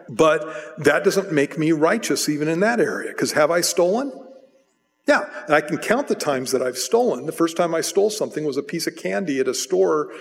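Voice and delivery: ordinary talk, nothing marked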